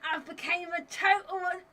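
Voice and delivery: loud, slow Cockney soap-opera shout; Cat Slater voice. Retold or imitated. Friend on a livestream does Cat Slater in a loud, slow Cockney shout. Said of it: "I've gotta do it in Cat Slater voice".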